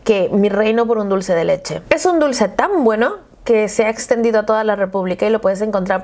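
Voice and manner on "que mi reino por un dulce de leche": ordinary delivery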